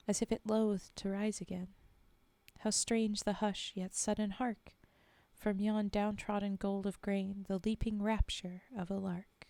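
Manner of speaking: measured, earnest poetry reading; solemn verse read aloud; poem recital. Acted. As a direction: read the war poem steadily, letting images land. Reads a war poem aloud.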